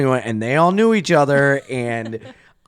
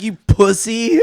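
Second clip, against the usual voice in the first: mock taunt; 9 semitones higher, 5 dB louder than usual. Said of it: "I mean, like".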